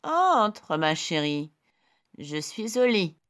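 high, loud put-on grandma voice; wolf faking a sweet granny; fake granny voice. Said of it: "de sa fausse voix de mamie".

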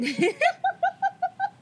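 high giggling burst; real laugh at her own slip of the tongue. Real, not acted. Host laughing after almost saying explainable instead of unexplainable.

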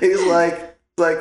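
ordinary talk, nothing marked

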